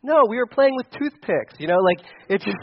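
usual speaking voice

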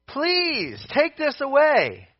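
high, drawn-out acted plea; pleading. Preacher as Paul begging God, high desperate plea. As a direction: beg with a long wailing fall. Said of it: "he pleaded that".

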